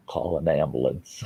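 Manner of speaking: faint, strained, barely voiced; weak near-whisper. Man in chest pain asks daughter for help, faint and strained. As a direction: push the words out with no breath left. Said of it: "I could whisper".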